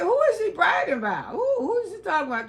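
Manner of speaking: who is she bragging about, laughing; surprised question. Retold or imitated. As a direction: ask fast in amused disbelief. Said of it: "I said".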